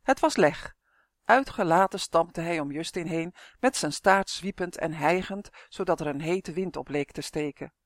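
plain reading voice

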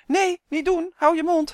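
high, loud urgent protest; alarmed shout. A narrator's reading. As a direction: cry out to stop him right now. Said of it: "riep Malien". (hoog en luid protesterend roepen)

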